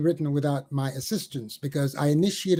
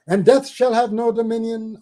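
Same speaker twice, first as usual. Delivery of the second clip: higher and louder, ringing refrain; 7 semitones higher, 5 dB louder than usual. Acted. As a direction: launch the refrain out boldly. Loud restart of poem refrain.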